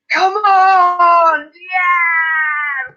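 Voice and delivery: loud, high, drawn-out cheer; proper shouting. Retold or imitated. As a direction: bellow a football celebration, holding the vowels. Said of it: "Proper shouting away".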